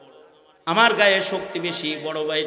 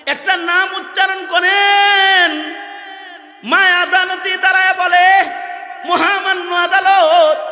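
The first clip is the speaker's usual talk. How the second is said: high, loud declamation with long-held phrase endings; high-pitched pulpit storytelling; impassioned preaching; 13 semitones higher, 7 dB louder than usual. Acted. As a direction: cry out the mother's courtroom plea at full force. Preaching at full force.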